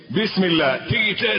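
normal talking voice

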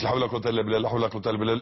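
لا حول ولا قوة إلا بالله gabbled, words swallowed; hurried mumbled dhikr; rushed careless recitation. Shown as a demonstration. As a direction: rattle it off carelessly. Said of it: "مش".

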